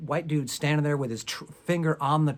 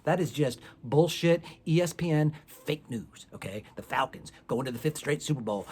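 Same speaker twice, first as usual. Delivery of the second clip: normal talking voice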